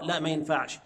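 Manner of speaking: ordinary delivery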